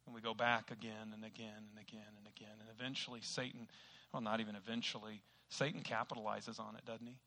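ordinary delivery

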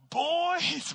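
big, high, loud shout; imitated booming fatherly yell; booming dad voice. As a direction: holler like a father summoning his sons. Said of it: "My dad had this big booming voice".

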